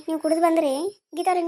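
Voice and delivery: normal talking voice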